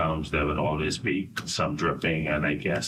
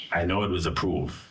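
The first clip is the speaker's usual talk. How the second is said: ordinary talk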